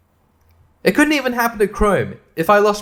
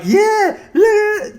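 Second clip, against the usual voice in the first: high mocking yeah yeah; mock cheery yes; 4 dB louder than usual. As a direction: toss it off like a smug pop-up. Mocking Bing's pushy pop-up tabs.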